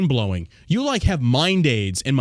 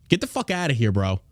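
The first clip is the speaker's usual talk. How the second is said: ordinary talk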